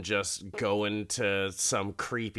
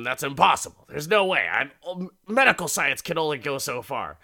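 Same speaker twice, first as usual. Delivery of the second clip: higher, louder voice of a doctor refusing; emphatic doctor; 4 semitones higher, 5 dB louder than usual. Retold or imitated. Doctor tells the old guy it's impossible, emphatic. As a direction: insist it can't be done, pitched up and forceful. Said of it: "the doctor tells him".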